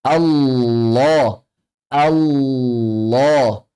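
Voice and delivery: "Allah" slow, thick l held on the tongue tip; tongue-tip lam. Shown as a demonstration. Teacher modelling the name, slow thick "Allah". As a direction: hold the doubled l in "Allah", ending on a rounded o. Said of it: "pakai ujung lidah sama".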